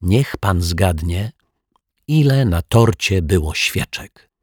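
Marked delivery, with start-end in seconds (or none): none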